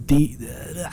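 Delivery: ordinary delivery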